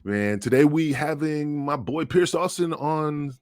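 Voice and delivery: normal talking voice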